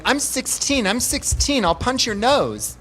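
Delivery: high-pitched mocking lisp; lispy teenager. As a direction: mimic a squeaky, lisping kid making threats. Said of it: "this lispy guy walks up and starts saying".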